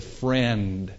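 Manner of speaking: soft, quiet words to a betrayer; a quiet, kind question to Judas; gentle Jesus. Acted. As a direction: speak it softly, like a friend. Jesus greets Judas in garden.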